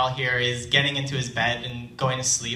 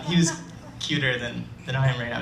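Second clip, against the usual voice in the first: ordinary talk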